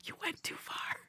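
whisper. Shown as a demonstration. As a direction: whisper it like sharing a secret. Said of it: "do a whine and a whisper".

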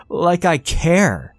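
put-on a boy flinging back at his mother; defiant shout. A narrator's reading. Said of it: "Danny shouted".

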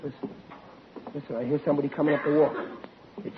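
low, quick, hushed alert; hushed warning. Acted. Plotter warns his fellas someone's coming, hushed warning. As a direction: keep it down and quick. Hears the intruder approaching.

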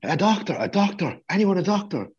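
very high mock screaming; high-pitched cry, without real volume; retold scream. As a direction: mimic someone frantically calling for help. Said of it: "runs out of the internal room screaming".

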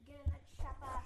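loud gaming call; excited callout. Real, not acted. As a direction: shout it eagerly.

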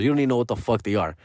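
usual speaking voice

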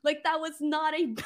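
giggling, delighted at himself; real laughter spilling through the words; laughing giddily. Real, not acted. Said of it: "that was the first time I've ever done that, and I surprised myself".

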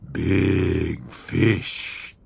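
low, throaty hunger for the fish; guttural greedy voice. A narrator's reading. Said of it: "cried in a guttural, greedy voice".

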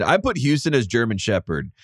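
ordinary delivery

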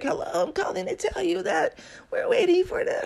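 high, soft, weak phone voice; sick caller on the phone. Retold or imitated. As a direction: sound like a colleague calling in sick. Voicing the sick colleague calling.

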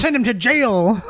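slow, high joke Irish voice; hammed-up fake Irish accent; bad Irish accent. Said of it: "I was doing Irish, man".

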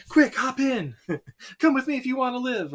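high, pressing call to hop in; urgent rescuer. Retold or imitated. Sarah calls Tommy into her car, high and urgent.

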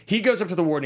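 usual speaking voice

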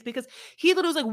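ordinary delivery

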